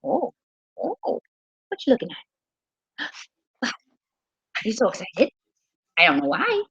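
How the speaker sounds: put-on excited pet voice. Shown as a demonstration. Talking to her dog in a put-on excited voice. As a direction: gush at the dog like it's thrilling. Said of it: "Because I'm using my excited voice".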